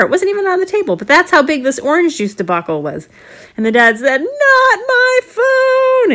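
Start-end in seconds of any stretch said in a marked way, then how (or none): 4.2-6.1 high, loud, very slow wail; dad wailing over his phone; dismayed cry. Acted. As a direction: stretch it out in comic horror. Dad sees soaked phone.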